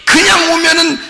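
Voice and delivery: mock-stern divine scolding; stern God. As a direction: bellow it as a stern judging God. Preacher voicing a stern God.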